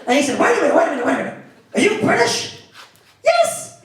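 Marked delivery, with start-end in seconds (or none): 0.4-2.6 imitated biker, higher and puzzled; a biker halting himself with a sudden question; surprised biker. 3.2-3.8 very high mock-panicked squeak; terrified yelp. Frightened answer to the biker.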